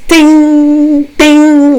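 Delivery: high, ringing metallic ding; long, sustained chime on one high note; imitated ding. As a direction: draw out a high spoon-on-porcelain ding. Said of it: "So all I heard was".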